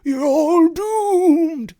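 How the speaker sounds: high, slow ominous prophet voice; slow ominous raspy drawl. Retold or imitated. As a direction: drag out the doom warning. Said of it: "and he's like".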